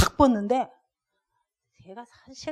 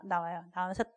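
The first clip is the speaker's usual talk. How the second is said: ordinary talk, nothing marked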